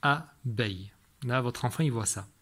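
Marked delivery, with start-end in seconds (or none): 0.0-0.9 "Abeille" split in two, A, pause, beille; "Abeille" broken into two separate beats; syllable by syllable. Shown as a demonstration. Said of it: "compter les syllabes".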